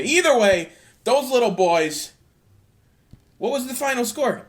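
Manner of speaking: ordinary delivery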